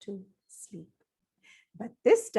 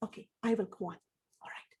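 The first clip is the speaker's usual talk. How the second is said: ordinary delivery, nothing marked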